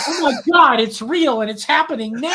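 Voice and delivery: high, loud mock panic; imitated screaming panic. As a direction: cry out like terrified shop customers. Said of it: "they started screaming".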